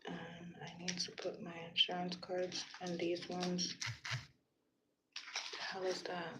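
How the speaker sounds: whispered under her breath to herself; hushed, voiceless muttering. Real, not acted. Sorting her insurance cards, she whispers to herself.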